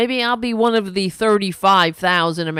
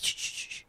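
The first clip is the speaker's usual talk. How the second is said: whispered shh shh shh; mock shush; 16 dB quieter, faster than usual. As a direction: hiss them quiet.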